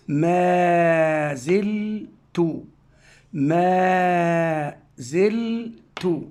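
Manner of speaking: ما زلت said very slowly, each sound apart; slow syllable reading. Shown as a demonstration. Teacher sounding ما زلت out slowly for pupils. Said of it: "ثم أنطق زي بلا مد".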